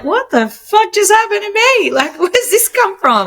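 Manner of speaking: high, squeaky mock bewilderment; a hammed-up what-just-happened squeal; baffled outburst. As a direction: play someone stunned by their own rage. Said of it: "and they're like".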